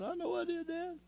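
the second admission falls apart completely; voice breaking. Retold or imitated. Son confessing again, voice breaking.

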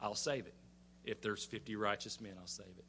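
low, quiet, settled reply; patient Lord answering. Retold or imitated. The Lord granting Abraham's plea for Sodom, quietly patient. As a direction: answer softly and low, with assurance. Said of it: "and the Lord says".

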